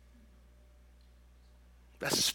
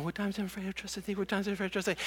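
fast, soft, panicked rattling of the verse; boy in bed reciting the verse, fast and soft; frightened rapid recitation; 2 semitones higher, 10 dB quieter, much faster than usual. Retold or imitated. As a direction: gabble it under your breath like a scared kid. Scared boy, closet door opening.

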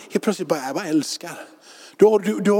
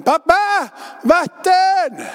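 put-on much higher, louder little kid crying out for water; small child calling; 6 dB louder, much slower than usual. Toddler calling to daddy at night, high and loud. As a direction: call out like a three-year-old in the dark.

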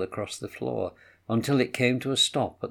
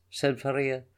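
plain reading voice

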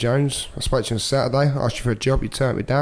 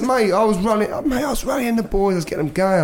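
excited retelling; 10 semitones higher than usual. Retold or imitated. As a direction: pitch climbs high, voice lifts as he gets carried away. Excitedly recalls rallying the players.

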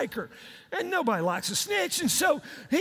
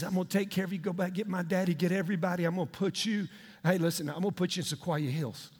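low, subdued voice of reconciliation; quiet, low retold promise; reassuring; 7 semitones lower, 4 dB quieter, faster than usual. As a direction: reassure them low and calmly. Joseph reassures his reconciled brothers.

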